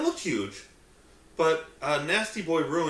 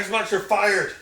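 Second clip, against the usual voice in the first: mock firing shout; 5 semitones higher, 7 dB louder, slower than usual. Firing the frozen Xbox.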